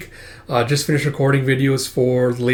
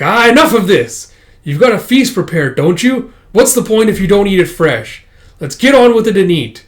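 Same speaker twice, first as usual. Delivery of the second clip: loud, higher fed-up demand; impatient outburst; 6 semitones higher, 6 dB louder than usual. Acted. Guest wanting the feast eaten fresh, impatient outburst. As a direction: cut the formalities short, acted out.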